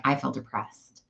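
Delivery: quiet, downcast mock gloom; acted depressed. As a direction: act out a slump, quietly deflated. Acting out feeling depressed by noon.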